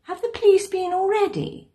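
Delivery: loud, high call to another room. A narrator's reading. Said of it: "I called out".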